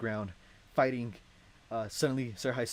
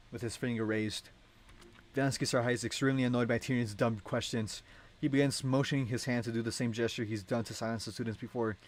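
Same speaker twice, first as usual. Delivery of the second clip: ordinary delivery, nothing marked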